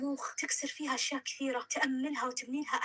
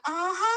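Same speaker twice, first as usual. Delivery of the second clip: loud, higher sarcastic aha; bitter aha; 6 semitones higher, 7 dB louder than usual. A narrator's reading. Nada realizing Sami lied, a loud bitter aha. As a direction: spit out the realization.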